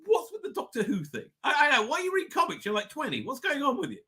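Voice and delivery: imitating a puzzled, nagging father; baffled dad. Dad questioning his son's hobbies, mild exasperation. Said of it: "My dad was like".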